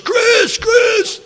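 high frantic yelling of a name; desperate late-night caller crying out; frantic shout. Retold or imitated. Andy calling in the night.

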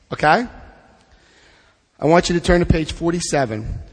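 normal talking voice

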